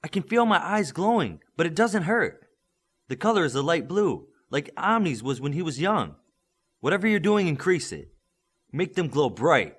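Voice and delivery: put-on high, eager young man's voice; young hero Midas. A narrator's reading. Midas telling his coach what he feels, eager and high. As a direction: let the wonder spill over.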